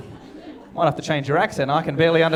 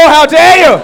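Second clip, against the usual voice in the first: much higher, louder mock shout; mock outrage; 10 semitones higher, 12 dB louder, slower than usual. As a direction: pretend offended at the crowd. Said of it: "it deserved more".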